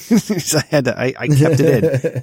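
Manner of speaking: ordinary talk, nothing marked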